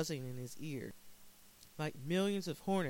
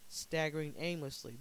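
plain reading voice

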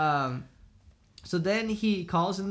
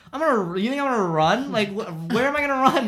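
raised, louder, incredulous protest; indignant disbelief; 5 semitones higher, 7 dB louder than usual. Retold or imitated. Teen retelling her reply to a suspicious cop, indignant. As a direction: protest as if the idea is ridiculous. Driver protests being suspected of running.

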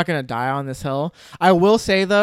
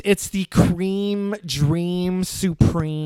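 slow, grand award announcement; announcer voice; 2 semitones higher, much slower than usual. Acted. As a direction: drag out the title like an announcer. Announcing the final award title.

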